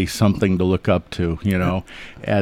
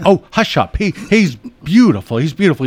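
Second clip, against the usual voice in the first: higher, louder mock-indignant old lady brushing off her sister; indignant hush up; 7 semitones higher, 3 dB louder than usual. Old lady snaps at her sister defending her dog, mock indignant. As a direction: play the lady fondly scolding her sister.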